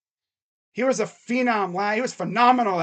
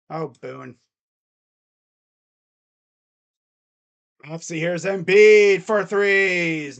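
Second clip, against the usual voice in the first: lightly voiced announcer call; live play-by-play; 2 semitones higher, 5 dB louder than usual. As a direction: calmly call the possession. Streamer calls the game live.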